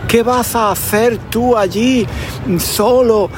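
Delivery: high-pitched, lightly acted weeping aunt; imitating a tearful, worried relative; mock tearful pleading. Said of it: "se echó a llorar".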